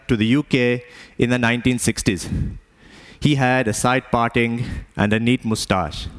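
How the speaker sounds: put-on Indian accent, storytelling; measured Indian-accented English. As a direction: tell it in a father's Indian accent. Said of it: "why I'm even talking like this".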